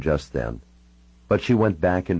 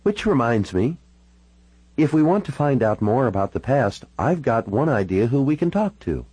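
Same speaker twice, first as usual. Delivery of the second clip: higher-pitched woman's character voice; Natalie proposing a plan; 7 semitones higher than usual. Acted. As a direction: voice her pitched up, putting an idea forward.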